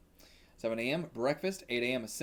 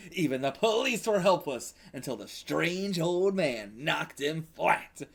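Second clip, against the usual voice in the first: dramatic narration; 3 semitones higher, 4 dB louder than usual. Acted. Reads the dramatic character intro.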